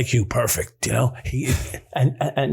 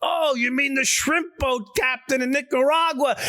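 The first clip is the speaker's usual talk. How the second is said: mock realization; 10 semitones higher than usual. Feigned realization to Kenny.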